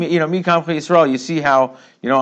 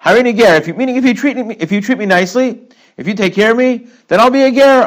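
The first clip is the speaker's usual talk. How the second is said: eager explaining quote; 9 semitones higher, 5 dB louder than usual. Retold or imitated. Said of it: "He says".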